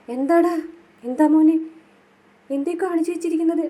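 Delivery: high, loud, shocked mock cry; imitating shocked mother. Mother seeing her beaten son, imitated shocked cry. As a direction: gasp it out as a horrified mother. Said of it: "Kamala ആകെ ഞെട്ടിത്തരിക്കുകയാണ്".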